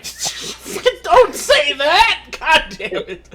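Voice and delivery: loud laughing yell; laughing outburst. Real, not acted. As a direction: shout through laughter. Horrified at crude joke.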